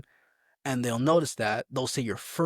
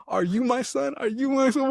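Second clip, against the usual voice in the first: very high, squealed mock question; mocking sergeant, imitated; 14 semitones higher, 4 dB louder than usual. Sergeant taunts recruit over his name, mocking squeal.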